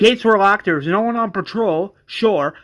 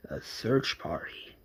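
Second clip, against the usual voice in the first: scornful whisper; 8 semitones lower, 12 dB quieter, slower than usual. Acted. Johnny mocking suggestion.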